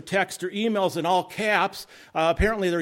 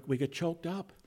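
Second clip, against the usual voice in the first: ordinary delivery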